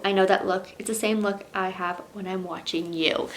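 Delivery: reading a novel aloud, steady and intimate; story narration. Acted. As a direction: read it like an audiobook. Reads the romance novel aloud.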